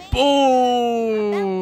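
high, loud, long-held shout; drawn-out holler. Real, not acted. Gamer reacting to a play, drawn-out holler. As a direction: belt it out and hold the vowel.

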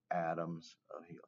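whispered to yourself as you write. Shown as a demonstration. Teacher whispers the label again while writing it for the class. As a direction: breathe the words, no voice. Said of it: "I forgot to label".

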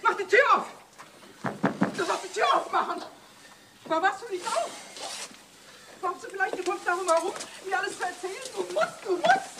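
high, pleading and demanding at the door; desperate demand. Acted. Wife demanding through the door that her husband talk, high and desperate. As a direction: beg and order him to open up.

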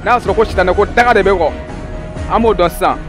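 ordinary delivery

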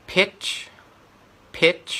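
"pitch" high, short IH, released CH; short vowel, CH. Shown as a demonstration. Tutor drills the first word for students, short vowel with CH. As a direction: clip "pitch" short and end on CH. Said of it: "อันนี้เสียงสั้นนะครับ มีเสียง C H ด้วย".

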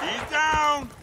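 shouting a kill in battle; combat callout. Acted. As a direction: yell it to the squad. Calling out in combat.

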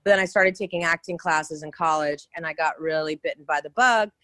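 normal talking voice

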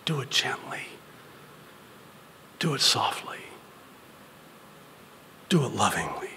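soft, spaced-out whisper; gently whispered. Shown as a demonstration. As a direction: whisper each plea tenderly. Demonstrates gentle, soft speech.

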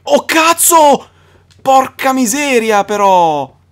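high, loud, slow drawn-out shock; stunned exclamation. Real, not acted. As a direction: gasp it out in disbelief. Huge enemy appears. (stupore lento e forte)

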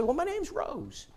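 a hint of a sweet grandma; woman's voice. Preacher voices older woman.

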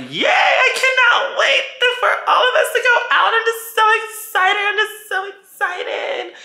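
very high, squealy excited gush; squealing excitement. Retold or imitated. Friend gushing about going out, squealing high. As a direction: squeal it like a thrilled friend who can't wait. S's overexcited quote in story.

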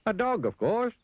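very high, confident answer; Mister Dizzy. A narrator's reading. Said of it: "replied Mister Dizzy".

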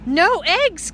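high, loud exclamation; cried out. Acted. Farmer Brown, upset about the hens, crying out loud. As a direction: yelp it in outrage. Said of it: "cried Farmer Brown".

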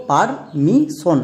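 Permission slowly in parts, par... mi... shon; syllable by syllable. Shown as a demonstration. Teacher shows a new example, permission slowly syllable by syllable. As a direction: break permission into separate, drawn-out syllables. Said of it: "যেমন দেখো".

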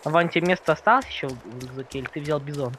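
ordinary talk, nothing marked

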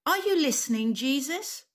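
high-pitched call-out; shouting. Shown as a demonstration. Storyteller and children calling to Jesus, shouting. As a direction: lead children in a shouted question. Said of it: "Can we all shout that too?".

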